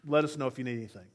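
quick brush-off. Retold or imitated. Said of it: "sent your son out to kinda say".

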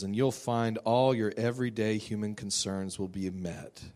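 ordinary delivery

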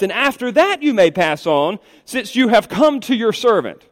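high, earnest scriptural plea; acted entreaty to honored guests; begging invitation. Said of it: "begging for these three men to stay".

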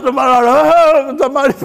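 ordinary delivery

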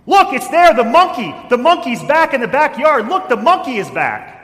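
put-on high, very loud excited yell to the kids; mother's full-volume fake alarm call; yelling at the top of her lungs. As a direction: shout it out the window to break up the fight. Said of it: "yelled at the top of her lungs".

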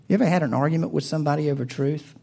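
ordinary talk, nothing marked

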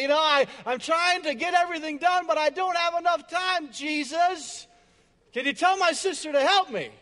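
banging pans and fed up, let it burst out; frustrated outburst. Retold or imitated. Martha in the kitchen snaps at Jesus, frustrated and loud.

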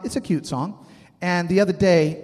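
ordinary talk

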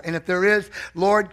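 usual speaking voice

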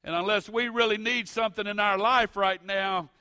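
loud, high, building preacher's cadence; preaching at full force. Acted.

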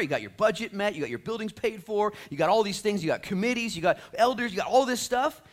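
high, loud, driving preacher voice; full-force preaching. Real, not acted. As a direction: pile up the list with rising force. Preacher builds up a list at full force.

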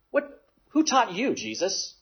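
high, scornful Pharisee question; mocking challenge. Pharisees scoffing at Jesus's teaching, high mocking challenge. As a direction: sneer it down at him.

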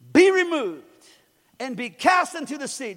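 high, loud command; preacher proclaiming Jesus' command; commanding shout. Acted. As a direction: order the mountain away. Commanding the mountain.

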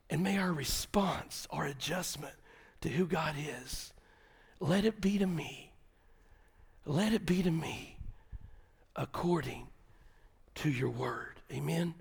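soft, whispered and slow; hushed prayer. Acted. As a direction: pray it quietly over them. Closing prayerful hush.

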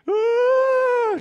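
very high, loud, sustained mock wail; demonstrated weeping wail. Shown as a demonstration. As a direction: wail out one long high note of exaggerated weeping. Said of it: "just like that, okay?".

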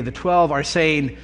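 ordinary talk

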